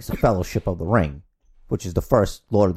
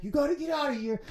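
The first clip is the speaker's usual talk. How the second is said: a much higher, soft pleading voice; quietly high, urging them to flee; lightly voiced urgent warning; Gandalf urging; 11 dB quieter, faster than usual. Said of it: "he's like".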